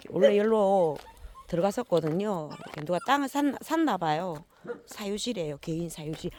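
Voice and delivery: normal talking voice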